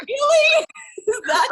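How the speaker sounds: high, loud, amused disbelief; incredulous really. Retold or imitated. Host reacting to guest's stubbornness, loud amused disbelief. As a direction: squeal it in mock disbelief.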